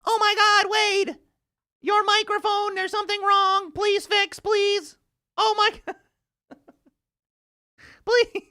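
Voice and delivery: very high, loud begging whine; mock pleading chat. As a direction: squeal like a panicked fan. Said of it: "toggle between the two".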